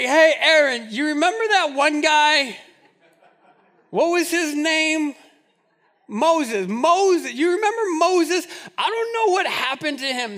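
whiny Israelites pestering Aaron, laughing; mock Israelites nagging Aaron. Israelites asking Aaron for new gods, hammed-up whining.

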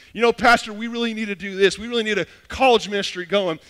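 eager church member. Retold or imitated. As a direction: pitch an idea to the pastor with mock urgency.

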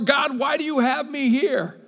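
high, loud plea; crying out to God. Acted. Preacher voicing a believer's complaint to God, high loud plea. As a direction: call up to God in frustration. Voiced prayer.

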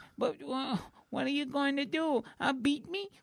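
high, stammering neurotic whine; Woody Allen. Acted. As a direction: plead like a nervous, put-upon nebbish. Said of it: "he kinda looks like Woody Allen".

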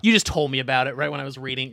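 usual speaking voice

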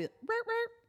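high, quick siren chirps; imitated police siren. Storyteller imitates the cop car pulling up behind, high chirps. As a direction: mimic a siren's short bursts.

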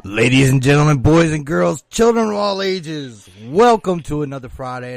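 booming ringmaster call. Acted. Circus-style show opening.

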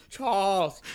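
hammed-up young Magneto. Shown as a demonstration. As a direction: call out to an old friend, villain style. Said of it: "That's a good young Magneto".

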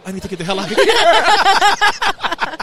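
high, loud, slow panicked plea; mock panic at the counter, slow and loud; panicked laughing rush. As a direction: beg to escape, half laughing. Panicked aside while ordering.

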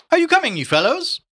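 high, loud call from a doorway. A narrator's reading. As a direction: call across to your companions, pitched right up. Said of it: "Haynes called to them from the doorway".

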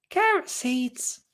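high, soft, slow little voice; Lottie's child voice. Acted. Lottie answers the looming Mister Thompson, small high soft voice. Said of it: "said Lottie".

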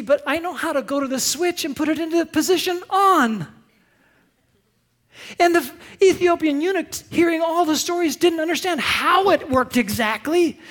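high, loud, fast sermon delivery; full-force preaching. Acted. Pastor preaching to the congregation, impassioned and loud. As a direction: preach it out, driving hard.

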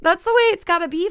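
high, loud princess voice; sing-song princess pitch, fast. Pretend. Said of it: "pretty princessier".

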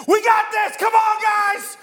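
high, loud rallying yell to his team; hype shout. Retold or imitated. Pastor cheering on his team at game night, loud hype shout. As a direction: fire up the team like a coach.

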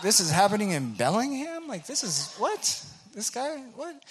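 high, incredulous disbelief climbing on what; can't believe what you're hearing; surprised. Retold or imitated. Overhearing a preacher at the V U, stunned. Shocked by loud preaching in Bellingham.

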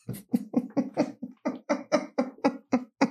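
squeaky giggle; high-pitched laugh. Real, not acted. High laugh after teasing.

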